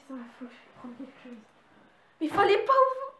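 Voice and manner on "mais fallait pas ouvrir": sudden high yell; startled shout. Real, not acted.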